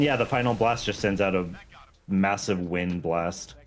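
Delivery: usual speaking voice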